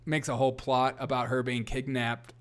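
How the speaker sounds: ordinary delivery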